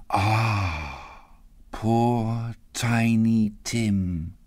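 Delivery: very slow, sorrowful sigh; a mother's subdued lament; grieving. Acted. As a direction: linger sadly on each word. Mother grieving for Tiny Tim.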